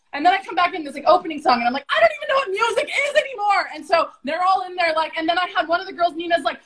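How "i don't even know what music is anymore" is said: fast, high-pitched wail of despair; tearful-sounding retold outburst, much higher; mock meltdown. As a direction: cry out in hammed-up overwhelm. Acts out overwhelmed outburst.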